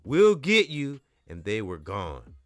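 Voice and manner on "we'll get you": loud, high threatening shout; threat. Acted. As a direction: threaten him as you flee. Bully's parting threat.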